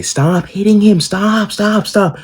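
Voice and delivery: put-on high childlike plea; little sister pleading. Little sister begging mom to stop hitting her brother, high childlike plea.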